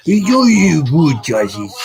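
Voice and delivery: a loud, slow outburst; crying out. A narrator's reading. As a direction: call it out loud, slowly. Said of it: "cried Sykes".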